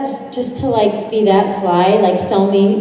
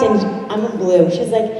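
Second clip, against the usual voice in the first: normal talking voice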